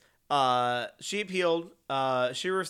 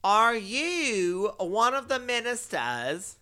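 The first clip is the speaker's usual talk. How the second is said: a badly faked British accent, slow and higher; mock British accent, higher and slow; 8 semitones higher, 4 dB louder, much slower than usual. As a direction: ham up a posh British question, drawn out. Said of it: "It was a bad accent".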